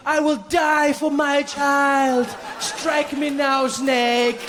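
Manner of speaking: slow, high, hammed-up self-sacrifice; heroic mother. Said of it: "she'd be like".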